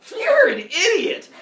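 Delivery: high, loud, drawn-out taunt; hammed-up schoolyard yell; jeering kids. Classmates mocking a boy's claim, loud high jeer.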